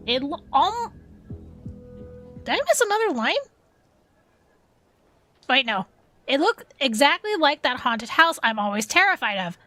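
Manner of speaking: ordinary delivery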